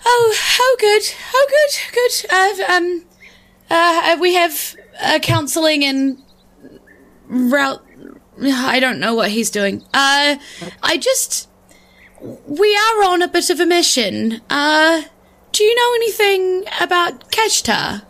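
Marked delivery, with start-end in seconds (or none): 0.0-7.8 high, stammering nervous hero; flustered high voice. Acted. Player answers in flustered voice. 9.9-18.0 high character voice. Acted. Player still in character voice.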